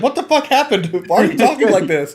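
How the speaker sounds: loud, high, baffled outburst; confused shout. Retold or imitated. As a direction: yell in shocked confusion. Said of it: "John's like".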